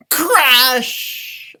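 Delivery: loud sound-effect crash; shouted crash sound. Acted. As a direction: call out the crash like breaking ice. Sound effect word in story.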